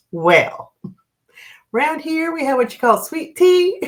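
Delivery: hammed-up Southern accent, climbing high; horrible Southern accent. Waitress explaining sweet tea to a newcomer, horrible Southern accent. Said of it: "my horrible accent".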